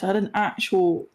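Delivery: ordinary talk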